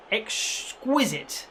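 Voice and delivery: exquisite drawn out slowly and softly, savoured; savoured word. Shown as a demonstration. Commentator picking a fancy word for the team's form, savoured. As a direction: relish the word exquisite like a pundit. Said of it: "I don't know whether to go for exquisite".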